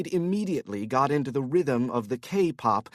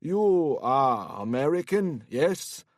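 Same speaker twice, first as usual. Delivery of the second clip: put-on Korean accent; slower than usual. Drunk Korean man speaking English.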